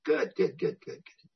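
softly chuckled words, trailing into breath; imitated chuckle. Amused sponsor answering an angry newcomer, chuckling. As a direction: chuckle through it like a pleased old-timer. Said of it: "the guy started to chuckle".

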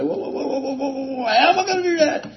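high, stammering whoa then a rushed question; stuttering protest. Retold or imitated. Man stuttering back at his sponsor, high panicked stammer.

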